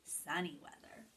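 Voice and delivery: hushed secret whisper. Real, not acted. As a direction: lean in and whisper it. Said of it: "unvoiced whisper".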